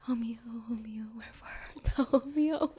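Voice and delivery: hammed-up, hushed theatrical lament; mock Shakespearean plea. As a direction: call longingly for it like a mock Romeo.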